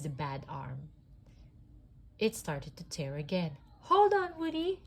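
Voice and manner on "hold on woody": very high, loud alarmed call; frantic cry. A narrator's reading. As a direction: shout to him before he falls. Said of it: "Jessie cried".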